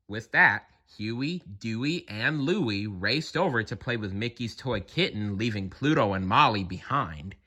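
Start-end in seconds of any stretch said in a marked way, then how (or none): none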